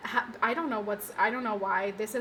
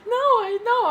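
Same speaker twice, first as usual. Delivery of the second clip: much higher, giggling denial; laughing no; 11 semitones higher, 7 dB louder than usual. Real, not acted. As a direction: wave it off while laughing.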